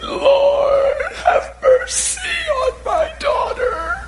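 a high, crying shout for mercy; crying plea. Acted. Mother clings to Jesus's cloak, crying out in a high wail. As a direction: wail it out, begging.

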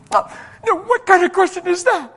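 high-pitched imitation of an upset woman; mock indignant outburst. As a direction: act out a woman lashing back. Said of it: "started letting me have it".

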